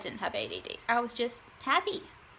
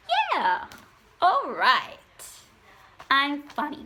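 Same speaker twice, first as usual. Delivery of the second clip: gleeful self-confidence; 3 semitones higher, 7 dB louder, slower than usual. Retold or imitated. Suddenly embracing being the funny one, hammed-up excitement. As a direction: play up a big, swooping self-approval.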